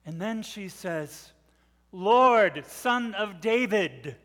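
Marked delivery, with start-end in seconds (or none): 1.9-4.2 loud punch on each "Lord"; calling out. Acted. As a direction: call out to get through to him. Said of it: "She's calling him".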